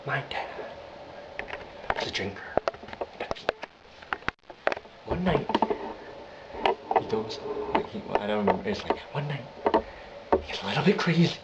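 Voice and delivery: deranged Joker, halting between phrases; Joker impression. Reciting the scars story, creepy Joker.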